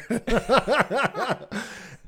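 real high-pitched laughter; bursting laugh. Real, not acted. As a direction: crack up at your own awkwardness. Laughs at own awkward message.

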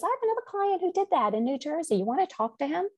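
high, quick put-on voice for the attorney; attorney's voice. Her attorney offers a New Jersey contact, in a high quick voice.

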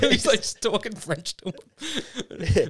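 slow, high, laughing through the words; helpless giggly retelling; real laughter. Real, not acted. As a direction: struggle to talk while cracking up. Cracking up retelling a favourite bit.

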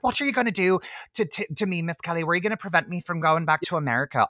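put-on high, quick County Wexford accent. Film heroine confronts Miss Kelly, acted Wexford accent. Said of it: "I have to speak in this accent".

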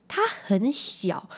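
very slow, drawn out; stretched child quote. Retold or imitated. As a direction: drag out the words like a wide-eyed kid. Quoting her small son.

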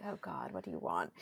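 low, hushed retort to mom; wary mutter. Retold or imitated. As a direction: drop way down, wary and under the breath. Daughter's wary reply to hovering mother.

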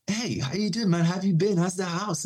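breezy small talk, voice lifted high; friendly neighbour. Retold or imitated. Retold friendly neighbour's greeting.

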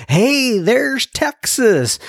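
high, drawn-out mock group greeting; saluto di gruppo imitato. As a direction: call out a welcome to a visitor, stretched and high. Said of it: "they didn't talk like that".

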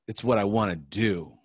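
ordinary delivery

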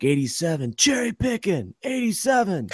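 high called-out warning to teammates; yelling to his bench about a cherry picker; retold yell to the bench. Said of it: "I yell to the team on the bench".

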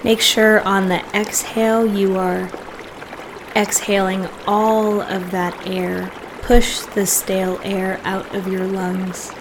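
soft, unhurried meditation voice with long pauses; guided meditation. Real, not acted. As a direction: gently guide listeners into settling and breathing.

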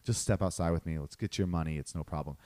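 imitating himself quietly de-escalating a big guy; calm de-escalating. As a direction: talk him down gently. Calming a huge angry man.

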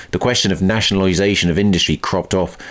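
ordinary delivery

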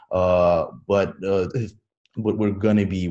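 ordinary talk, nothing marked

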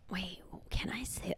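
very soft, half-whispered question; hushed aside. Real, not acted. Podcaster checking with co-host what she may say, whispered.

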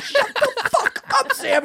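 high, slowed, laughing yell; mock yell back. Telling Sam off down the street, high mock yell. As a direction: shout him down in jest.

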